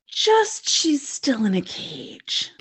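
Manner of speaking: slow, disbelieving stress on cage; exasperated. Real, not acted. Fan exasperated at the bad timing, slow. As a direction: spell out the absurdity.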